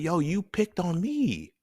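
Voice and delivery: put-on high, slow protesting kid; mock student complaint to the teacher; younger self. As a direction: whine it like a wronged twelve-year-old. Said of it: "it's like".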